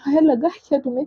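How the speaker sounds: same question, rushed and fast; urgent repeat. Retold or imitated. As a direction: hurry the repeat out anxiously. Asked three times, repeated faster.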